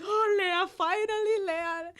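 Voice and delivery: loud, high mock wail of relief; imitated tearful cry. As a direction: wail it like an overcome viewer. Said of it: "my mom and I were crying".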